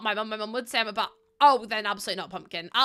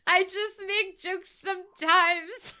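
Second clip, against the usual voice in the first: laughing; 9 semitones higher, 6 dB louder, slower than usual. Real, not acted. Laughing repeat of own quote.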